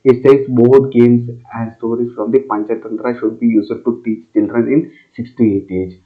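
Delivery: usual speaking voice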